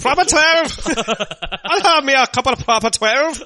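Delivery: loud, very high mock Irish holler; hammed-up Irish accent. Host playing tavern patron ordering whiskey, loud mock Irish accent. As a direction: order a drink in a shouted cartoon Irish accent. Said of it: "that's a good Irish accent".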